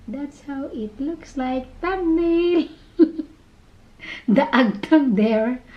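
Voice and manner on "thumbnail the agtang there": laughing. Real, not acted. Posing for thumbnail, laughing.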